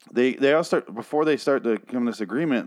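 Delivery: ordinary talk, nothing marked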